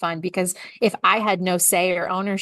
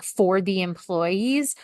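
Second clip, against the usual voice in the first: normal talking voice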